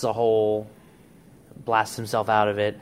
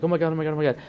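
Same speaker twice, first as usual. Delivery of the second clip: higher, rapid-fire imitated panic; lightly acted "what have I done" panic; mock panicked realization; 5 semitones higher, much faster than usual. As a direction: act out a sudden horrified realization. Acting out horrified realization.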